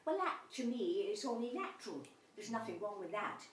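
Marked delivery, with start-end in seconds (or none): none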